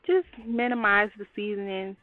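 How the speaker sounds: usual speaking voice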